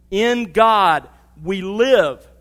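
slow, loud, weighty quotation; emphatic scripture. Acted. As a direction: lean on each word of the verse.

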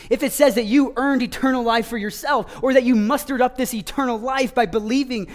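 preacher pressing the text on the congregation, impassioned; impassioned preaching. Acted. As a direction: build to a ringing no. Preacher builds argument to climax.

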